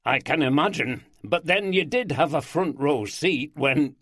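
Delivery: put-on heightened Yorkshire accent, amused. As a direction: lean into broad Yorkshire. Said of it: "heightening their accents".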